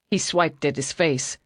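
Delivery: ordinary delivery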